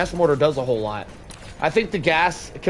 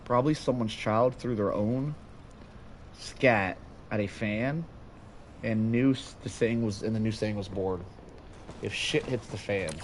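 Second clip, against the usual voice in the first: mumbling quietly; 8 semitones lower, 13 dB quieter than usual. Real, not acted. Mumbling to himself very softly.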